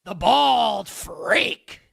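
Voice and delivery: slow, stretched delivery leaning on bald; announcer demo. Shown as a demonstration. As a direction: hit "bald" hard and stretch "the bald freak" slowly. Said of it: "I'm more of, like, the".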